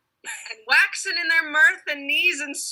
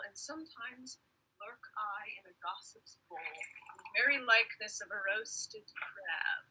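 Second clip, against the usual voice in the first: whispering; 13 dB quieter than usual. Acted. Puck hides in a bowl.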